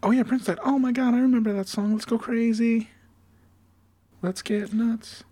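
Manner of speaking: high-pitched mock enthusiast; high mock-excited fan voice; mocking fake fandom. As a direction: gush in a high, put-on voice like a bandwagon fan. Mocking people who suddenly love [name].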